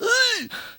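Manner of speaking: sharp high choking gasp. Retold or imitated. Choking woman gasping in the kitchen, high gasp.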